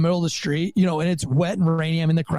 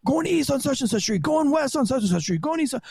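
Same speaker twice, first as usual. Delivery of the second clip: higher, rapid-fire radio calls; dispatcher voice; 7 semitones higher than usual. Retold or imitated. Rookie cop imitating radio calls, lightly voiced. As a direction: rattle off directions like dispatch. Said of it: "They're like".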